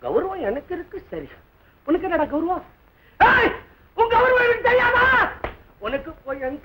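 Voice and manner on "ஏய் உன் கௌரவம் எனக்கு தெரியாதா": high-pitched scornful yell; angry outburst. Acted. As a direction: throw his honour back at him. Angry quarrel about honour. (கோபமான கத்தல்)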